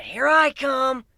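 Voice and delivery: high, loud, slow yell; whooping shout. Acted. Gilda diving off the stage, loud slow yell. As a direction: let it rip as you jump.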